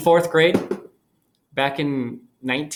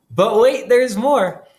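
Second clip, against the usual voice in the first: infomercial announcer; 8 semitones higher, 6 dB louder, slower than usual. Pretend. Infomercial catchphrase to students.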